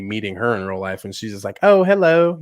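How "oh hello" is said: put-on British accent. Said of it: "she doesn't have that accent".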